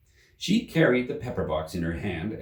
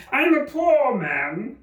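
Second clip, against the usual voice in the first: very high, slow, louder whimper; pleading Hatter; 7 dB louder, much slower than usual. Acted. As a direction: beg for mercy.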